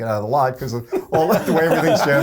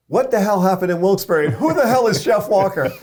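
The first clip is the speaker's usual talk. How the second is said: higher, louder angry demand; irate demand; 8 semitones higher, 4 dB louder than usual. Retold or imitated. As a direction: bark it down the phone line. National rep angry on phone.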